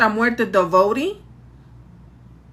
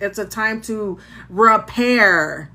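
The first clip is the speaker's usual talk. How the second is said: ordinary delivery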